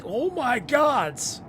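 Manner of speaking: high, loud shocked outcry. Real, not acted. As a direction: gasp it out in shock. Sees monster.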